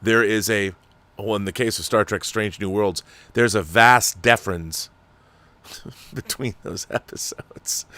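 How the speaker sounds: ordinary talk, nothing marked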